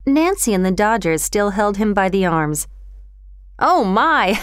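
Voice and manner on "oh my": exclaim, then crack up; helpless laughter. A narrator's reading. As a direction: burst out laughing on the words. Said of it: "unable to hold in his laughter".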